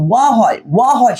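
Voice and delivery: ওয়া said clearly as a rounded wa sound, twice; showing the wa sound. Shown as a demonstration. Teacher demonstrating the wa sound to students, clear and deliberate. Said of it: "ও এর উচ্চারণ যে ওয়া".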